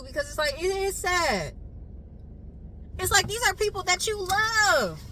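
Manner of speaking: fighting the tears as she speaks; holding back tears. Real, not acted. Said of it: "I'm trying not to cry too".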